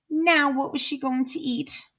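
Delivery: ordinary delivery, nothing marked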